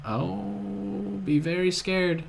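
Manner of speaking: ordinary talk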